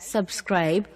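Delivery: ordinary delivery, nothing marked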